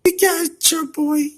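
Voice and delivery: high-pitched teasing cartoon voice; squeaky character voice. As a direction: tease like a tiny pet. Voices character answering back.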